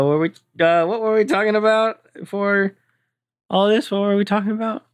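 tipsy, rambling and high; drunk slur. Acted. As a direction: lose the thread like a drunk. Drunk man losing his thread.

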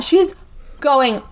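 normal talking voice

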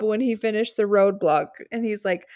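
normal talking voice

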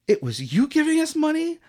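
high mock-female voice of astonishment; woman's voice. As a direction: pitch it high, acting astonished. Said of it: "and she's like".

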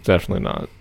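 ordinary talk, nothing marked

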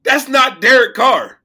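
loud disbelieving shout. Retold or imitated. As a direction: yell it in disbelief. Realizing it's someone else.

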